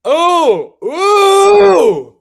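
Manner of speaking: high rising ooh; excited squeal. Real, not acted. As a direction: squeal with delight.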